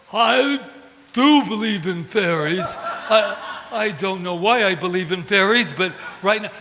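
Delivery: hammed-up booming villain confession; blustering Captain Hook. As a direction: bellow it out in mock bewilderment. Hook helplessly joins the chant.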